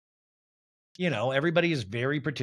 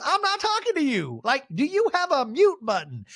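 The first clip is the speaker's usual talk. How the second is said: much higher, imperious retort; haughty snap; 11 semitones higher than usual. Retold or imitated. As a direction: put her in her place. Mary snapping at Lisa.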